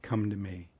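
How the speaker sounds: softly inviting Mary closer; gentle invitation. Retold or imitated. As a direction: quietly draw her in. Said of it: "He says".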